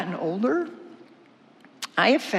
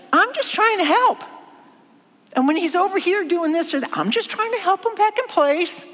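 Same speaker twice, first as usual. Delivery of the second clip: whiny self-defense; 8 semitones higher than usual. Acted. Wife complaining to God about her husband, high defensive whine. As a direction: protest your innocence in a high whine. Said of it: "kinda getting frustrated".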